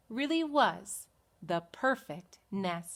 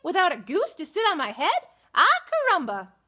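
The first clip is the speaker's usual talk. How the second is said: high, loud hen with a Spanish flair; Spanish-accented chicken; 8 semitones higher, 6 dB louder than usual. Acted. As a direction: exclaim like a fiery Spanish hen. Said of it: "cried the chicken".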